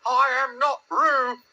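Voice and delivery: high, slow, emphatic protest; loud. A narrator's reading. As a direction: declare it loudly in a squeaky little voice. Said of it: "said Piglet loudly".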